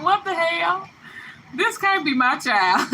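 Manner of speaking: put-on a mother who can't recognise her own child; disbelieving mama. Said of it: "Your mama gonna be like".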